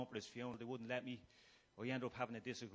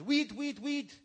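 "Weed" yelled much higher and louder, mocking the corporal; shouted French huit; 12 semitones higher, 10 dB louder, much slower than usual. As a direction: bark "weed, weed" like an angry corporal. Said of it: "your man says".

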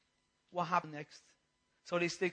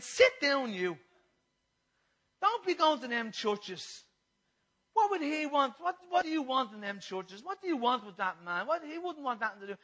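raised, louder telling-off; mock scolding; 4 semitones higher, 5 dB louder than usual. People opposing a churchgoer, loud mock scolding. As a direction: scold like a disapproving objector. Acting out scornful opponents.

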